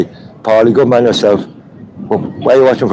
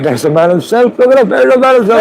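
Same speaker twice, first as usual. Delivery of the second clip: excited calling out. Real, not acted. Streamer to viewers spotting the man, excited high call. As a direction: hail him like a hero arriving.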